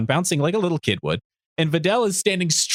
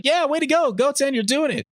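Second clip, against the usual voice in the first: much higher excited cheer; mock big-brother cheer, pitched way up; 11 semitones higher than usual. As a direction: Gohan cheering Goten on. Gohan cheering Goten.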